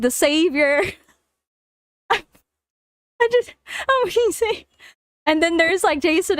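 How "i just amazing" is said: high, breaking up laughing; crying with laughter. Real, not acted. Reader overcome by the story, crying with laughter. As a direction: barely get the words out for laughing. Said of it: "Now I'm crying like Jason".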